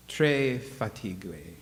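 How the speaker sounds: slow, drawn-out French "Très fatigué"; "Très fatigué" dragged out in halting French; mock French. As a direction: say "Très fatigué" slowly in a shaky French accent. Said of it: "I'm sure I messed that up".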